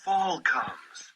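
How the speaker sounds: usual speaking voice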